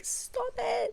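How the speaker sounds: tiny high, soft squeal; touched disbelief. Retold or imitated. Reacting to the mom's story, melting. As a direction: squeak it softly, melting with delight. Acts out melting at cute child.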